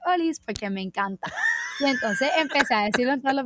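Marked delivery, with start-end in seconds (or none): 0.1-0.4 catchphrase greeting. Retold or imitated. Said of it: "Y yo siempre".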